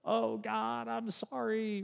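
high, soft mock contrite sigh; a put-on, drummed-up sorry; hammed-up tearful apology; fake apology. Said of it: "are we supposed to just drum up like".

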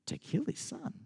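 preacher whispers the shocking command; hushed whisper. Real, not acted. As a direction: whisper the horror of it. Shocking turn in the story.

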